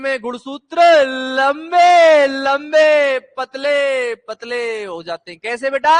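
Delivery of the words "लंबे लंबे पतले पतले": loud, hugely stretched vowels easing off; cantilena didática. Shown as a demonstration. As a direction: drag each word out for the class to copy. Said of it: "मधुर आवाज में बड़ी ही आराम से".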